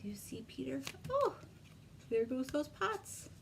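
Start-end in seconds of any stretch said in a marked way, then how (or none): none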